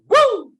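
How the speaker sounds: very high, loud wrestler's woo; Ric Flair woo. Retold or imitated. Fan imitating Ric Flair, loud high woo. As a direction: let out the wrestler's signature yell.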